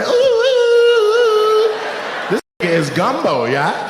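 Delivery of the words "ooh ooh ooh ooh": long, high mimicked "ooh"; loud drawn-out falsetto howl; high crowd whoop. Comic mimics someone in the crowd, high whooping. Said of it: "Somebody say".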